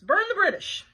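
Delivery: high, loud waitress holler; short order yelled out. Acted. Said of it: "hollered the waitress".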